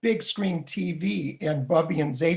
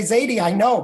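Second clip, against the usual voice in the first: put-on higher, louder kid's protest; protesting grandchild; 5 semitones higher, 6 dB louder than usual. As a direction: play an impatient little boy. Child protesting to doting grandfather.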